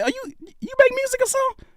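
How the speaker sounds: high-pitched, quick mock question; imitating curious questioner. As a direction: put on a high voice, asking in a rush. Quoting curious coworkers at Quiznos.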